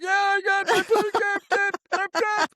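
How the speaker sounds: high, loud hammed-up sobbing; crying boy insisting to the teacher; mock crying. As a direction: wail it through fake tears. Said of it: "And I'm crying, can barely talk".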